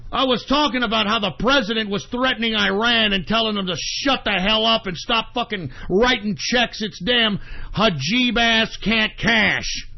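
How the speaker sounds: ordinary delivery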